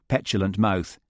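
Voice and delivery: ordinary delivery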